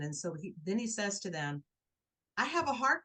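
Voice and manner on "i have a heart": higher, louder mock plea of a man faking illness; imitating his staged panic, pitched up; faked distress. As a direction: ham up a fake medical emergency. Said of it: "He goes into this whole entire act".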